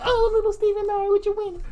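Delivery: very high, whiny, put-on whimper; hammed-up crying weakling voice; mock crybaby whine. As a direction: squeak it out like a wimp who just got knocked down. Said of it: "went down crying like".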